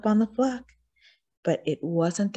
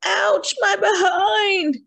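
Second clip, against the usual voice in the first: much higher, loud yelp of pain; howling in pain; 13 semitones higher, 5 dB louder, slower than usual. A narrator's reading. Wolf tossed into the air, howling in pain. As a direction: howl as the horns hit. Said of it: "howled Lou".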